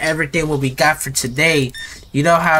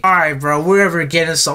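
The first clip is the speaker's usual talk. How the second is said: ordinary talk, nothing marked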